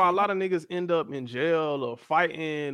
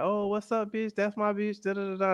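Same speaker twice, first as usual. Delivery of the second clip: higher, softer imitation of chummy women; put-on friendliness, pitched up and light; mock friendly greeting; 6 semitones higher, 5 dB quieter than usual. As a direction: lightly mimic gushing girlfriends. Mimics women's fake friendliness.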